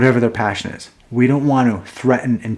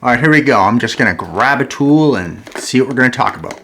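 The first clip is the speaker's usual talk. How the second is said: ordinary talk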